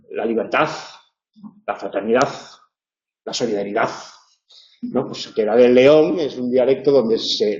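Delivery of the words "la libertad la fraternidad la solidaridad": mimicking Leonese final z. Teacher imitating a former prime minister's Leonese d. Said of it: "que decía siempre".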